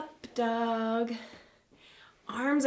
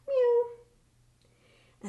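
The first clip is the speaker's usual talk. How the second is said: high spooky cat meow; Halloween cat meow; 13 semitones higher, 3 dB louder than usual. Acted. Yoga teacher showing cat pose to kids, high spooky meow. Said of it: "kinda like a Halloween cat".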